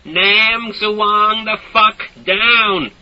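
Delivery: slow, high, loud snarl. A narrator's reading. Soldier snarling at villagers, slow and loud. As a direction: drag it out in a sneer. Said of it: "he'd snarl".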